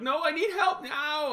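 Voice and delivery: put-on high, drawn-out old lady demand; wailed mock plea; insistent old lady. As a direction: imitate an old woman insisting, slow and high. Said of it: "and then she's like".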